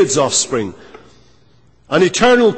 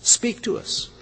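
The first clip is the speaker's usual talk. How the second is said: breathy whispered plea, no voice; 6 semitones higher, 8 dB quieter than usual. Acted. Preacher voicing the people's plea, whispered.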